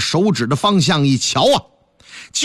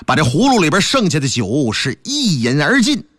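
usual speaking voice

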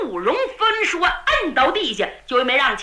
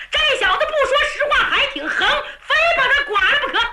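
much higher, rapid, half-laughing fury; angry threat; 11 semitones higher, faster than usual. A narrator's reading. Tang Qiong glaring and threatening to flay the captive. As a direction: bark it out fast with a glare.

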